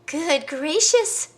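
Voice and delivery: high, slow, gasping bird voice; a nosy bird clucking in shock; gossipy bird. Acted. Nosy bird peeking at bald Hubert, high and amazed.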